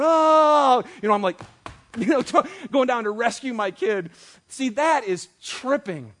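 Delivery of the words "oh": high, loud mock crowd gasp; hammed-up shocked crowd "Oh". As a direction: gasp like a whole stadium at once.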